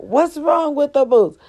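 high, lightly voiced worried mama; mama asking. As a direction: imitate mom's worried question. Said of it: "mama asking".

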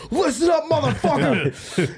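hammed-up motivational drill yell, very high; Goggins. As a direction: imitate a hard-driving motivator with drawn-out, strained high cries. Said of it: "then it cuts to Goggins".

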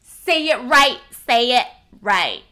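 loud, bossy mock correction; mock strict teacher. Imagining English speakers nagging like Cantonese ones, loud and bossy. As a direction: bark it like a fussy language teacher. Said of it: "imagine people talk like that in English".

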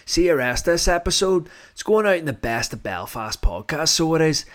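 hammed-up broad Belfast accent, swearing, laughing at the end; over-the-top Belfast accent for the podcast intro; exaggerated Belfast accent. Shown as a demonstration. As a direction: lay the Belfast accent on thick, mate. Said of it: "record this introduction here with a Belfast accent".

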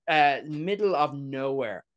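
usual speaking voice

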